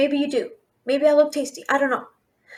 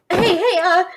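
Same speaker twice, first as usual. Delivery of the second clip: normal talking voice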